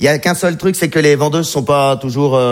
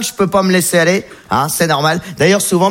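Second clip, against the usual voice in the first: ordinary delivery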